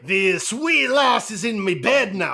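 smug Scottish accent, hammed up; mock Scottish brogue. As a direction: gloat in a put-on Scottish brogue. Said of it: "He's like".

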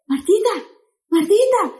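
high teasing call of Martita; sing-song Martita to get her attention; sister's high coaxing call. Retold or imitated. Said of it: "me dice".

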